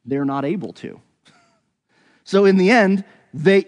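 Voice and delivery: normal talking voice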